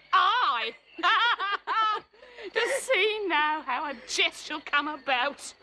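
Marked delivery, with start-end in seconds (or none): 0.1-0.7 mock toddler voice, a little higher and louder; squeaky little child's aye. Nurse imitates crying baby Juliet, mock child. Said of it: "the pretty wretch left crying and said". 1.0-2.0 laugh. Real, not acted. Nurse laughing at her own story.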